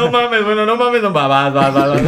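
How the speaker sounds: high, loud, laughing disbelief; excited disbelief. Retold or imitated. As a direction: blurt it out half laughing. Voicing the apostle's disbelief.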